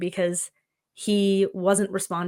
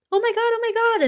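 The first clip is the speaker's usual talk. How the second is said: very high, gushing squeal; excited puppy greeting; 5 dB louder, much faster than usual. Retold or imitated. Greeting the puppy at the door in an excited squeal. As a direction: hype the dog up with a squeaky voice. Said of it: "get him all excited".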